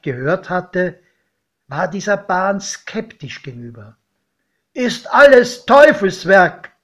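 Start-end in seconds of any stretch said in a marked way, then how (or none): 4.7-6.7 put-on raised, higher voice of a wary old farmer; godfather's grumble. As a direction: grumble loudly that it's the devil's work. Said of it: "meinte er".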